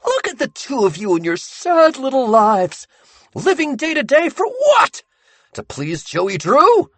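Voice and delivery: high, loud, contemptuous sneer; mock their little lives; sneering Sammy. Sammy scorning the boys for serving Joey Drew.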